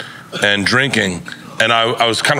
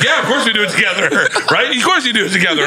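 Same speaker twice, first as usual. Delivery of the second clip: higher, fast, laughing [name]; a hint of an amused [name]; [name]'s reply; 8 semitones higher, faster than usual. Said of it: "he's like".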